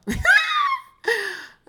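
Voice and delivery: loud shrieking laugh burst; loud high laugh. Real, not acted. Big laugh at Virgos.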